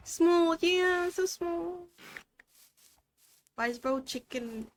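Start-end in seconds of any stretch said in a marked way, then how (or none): 0.1-1.9 cooing excitement. Real, not acted. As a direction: coo over something tiny and cute. Cooing at baby horse.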